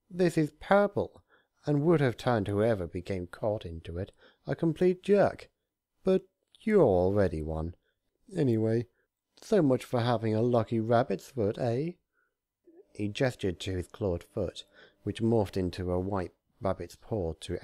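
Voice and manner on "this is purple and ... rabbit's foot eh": high, louder teasing jibe; mocking Discord. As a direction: needle the rabbit with relish.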